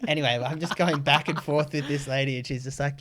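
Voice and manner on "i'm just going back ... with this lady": ordinary delivery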